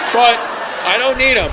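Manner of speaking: ordinary talk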